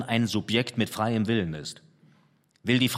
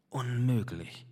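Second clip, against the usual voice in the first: a softer, pained sigh of a word; tormented; 4 dB quieter, slower than usual. Acted. Painter giving up before the canvas, a soft pained sigh. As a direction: breathe it out as if it hurts. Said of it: "gab er gequält von sich".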